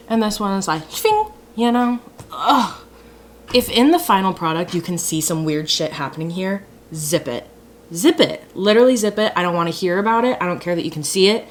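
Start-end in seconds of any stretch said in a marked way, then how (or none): 0.9-1.3 high sound-effect shving; mock shving noise. Imitating liner shape. 6.9-9.3 building louder playful order; playful zip it. Real, not acted. Telling viewers to hush. 9.4-11.5 rattled off fast; fast defensive. Real, not acted. Rushing defensively.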